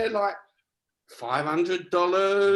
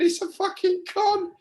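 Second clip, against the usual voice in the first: much higher, incredulous protest; retold scoff; 14 semitones higher than usual. To Jen, about the elephant art: a high incredulous scoff. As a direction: squeal that it's a con. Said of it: "And I'm going".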